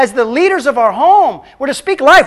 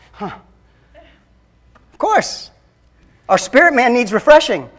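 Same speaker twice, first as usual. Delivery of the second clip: ordinary delivery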